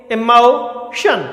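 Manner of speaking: इमोशन split slowly into emo and shan; syllable by syllable. Shown as a demonstration. Teacher showing the vowel in the word, इमोशन slowly in parts. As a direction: stretch the o of इमोशन, pause before shan. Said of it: "ओ है ये".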